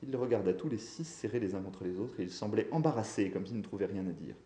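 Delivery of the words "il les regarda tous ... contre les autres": ordinary delivery, nothing marked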